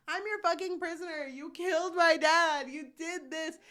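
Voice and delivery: high, loud, fast outburst imitating a wronged captive; acted furious rant; mock angry accusation. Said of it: "being fucking angry about her dad".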